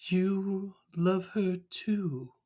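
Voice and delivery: lightly voiced Burns; Burns quote. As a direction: draw it out like Mr Burns. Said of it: "He goes".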